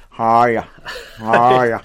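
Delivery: loud, exaggerated American pirate twang; bad American accent. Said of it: "You tried to do an American accent again".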